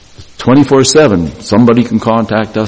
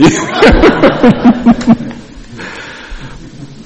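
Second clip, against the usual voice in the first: real laugh. Real, not acted. Laughing at his own story.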